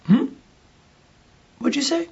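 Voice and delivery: mock double take in a small high voice; taken aback. Re-enacts being suddenly taken aback.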